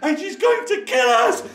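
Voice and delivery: high, loud urgent plea; scared thief. Acted. The thief tells his friends to flee, high and loud. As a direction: beg the gang to flee. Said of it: "he said".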